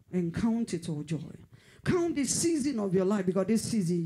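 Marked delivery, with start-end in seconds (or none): 1.8-4.0 higher, louder preaching cry; fervent cry. Acted. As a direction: cry it out to the crowd. Preacher rising in fervor.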